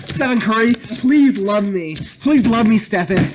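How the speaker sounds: mock fan begging Stephen Curry for love; mock lovesick fan. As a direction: beg Stephen Curry to love you, hammed-up.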